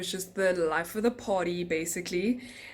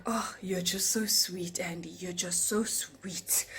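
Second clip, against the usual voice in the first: soft, slow whisper; whispered flattery; 5 dB quieter, slower than usual. Retold or imitated. Drunk passenger sweet-talking the flight attendant, whispered. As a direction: breathe the compliment. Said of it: "And he's like".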